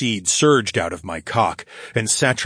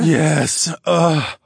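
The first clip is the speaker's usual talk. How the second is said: strained, louder growl; 7 semitones higher, 5 dB louder than usual. A narrator's reading. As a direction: growl it, loud and pitched up. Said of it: "I growled into her ear".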